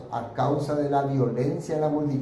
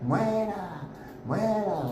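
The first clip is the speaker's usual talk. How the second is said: retold crowd yell; 7 semitones higher, much slower than usual. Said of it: "venía detrás gritando".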